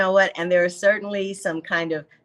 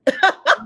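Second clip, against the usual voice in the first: a sudden high laugh bursts out; 12 semitones higher, 8 dB louder than usual. Real, not acted. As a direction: break into a short high laugh. Laughing at retold divorce line.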